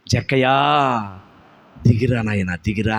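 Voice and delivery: Jesus calling Zacchaeus down from the tree; tender call. Acted. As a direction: coax him down kindly.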